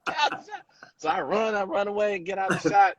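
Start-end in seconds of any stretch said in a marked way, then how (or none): 0.1-0.6 high, fast, breathless laugh through it; amused whispered echo of the yell; laughing repeat. Retold or imitated. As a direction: giggle the order out.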